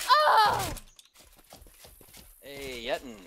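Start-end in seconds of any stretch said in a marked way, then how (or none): 0.1-0.7 loud, much higher startled oh; sudden surprised yelp. Real, not acted. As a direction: blurt it out in shock. Sudden exclamation in game.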